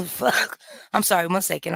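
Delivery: words squeezed out between wheezes; wheezy voice. Real, not acted. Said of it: "I'm choking".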